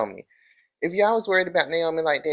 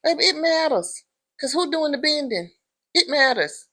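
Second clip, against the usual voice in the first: insistent; 10 semitones higher than usual. Real, not acted. Speaker insists on her point.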